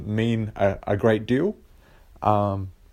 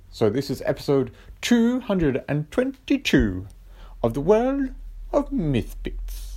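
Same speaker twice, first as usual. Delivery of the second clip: put-on dodgy Richie Benaud accent; 6 semitones higher than usual. Host announces the episode in a mock Richie Benaud accent. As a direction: read the episode intro as Richie Benaud would. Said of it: "that's my, uh, dodgy Richie, Richie Benaud accent".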